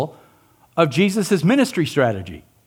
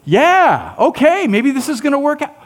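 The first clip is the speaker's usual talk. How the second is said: excited; 9 semitones higher, 4 dB louder than usual. Retold or imitated. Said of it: "Peter and John high-fiving".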